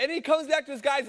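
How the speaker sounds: normal talking voice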